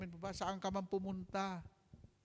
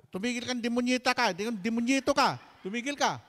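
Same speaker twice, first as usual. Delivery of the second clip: high, loud scolding shout; yelling at the child; 7 semitones higher, 10 dB louder than usual. Retold or imitated. Mother yelling at the crying child, high and loud. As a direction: yell at the crying kid to stop. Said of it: "umiyak yung anak nila".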